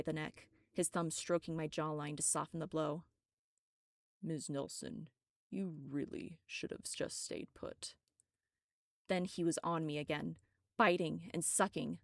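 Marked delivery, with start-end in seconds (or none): 4.2-7.9 quietly menacing vampire toying with his victim; soft menacing vampire. Acted. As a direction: purr the threat softly into her ear.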